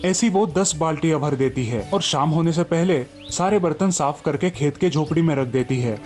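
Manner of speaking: usual speaking voice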